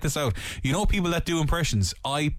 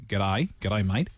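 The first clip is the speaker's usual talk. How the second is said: low, easy put-on Australian accent; relaxed imitation Australian accent; 6 semitones lower, slower than usual. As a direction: offer a lower Australian accent. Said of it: "What about, like".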